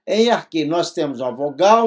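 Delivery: ordinary talk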